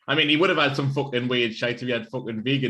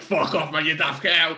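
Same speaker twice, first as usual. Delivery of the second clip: louder, higher retold outburst; playful indignant telling-off, raised voice; mock outrage; 4 semitones higher, 5 dB louder, slower than usual. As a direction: act out being appalled at a friend. Retold outburst at the vegan cookie.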